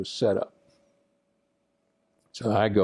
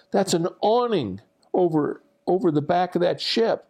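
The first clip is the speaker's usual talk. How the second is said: high-pitched mock scolding; imitated screaming; 9 semitones higher than usual. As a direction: scold the trainee in a strained, high voice. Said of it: "starts screaming at me".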